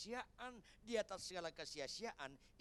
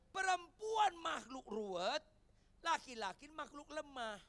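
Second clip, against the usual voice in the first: higher and louder, slow; preaching emphasis; 10 semitones higher, 9 dB louder than usual. Acted. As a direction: preach it out to the hall. Preacher's punchline at full force.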